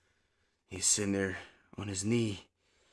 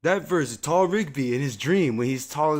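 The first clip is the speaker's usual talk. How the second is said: louder, higher, eager retelling; excited recall; 5 semitones higher, 6 dB louder than usual. Real, not acted. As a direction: gush about the episode.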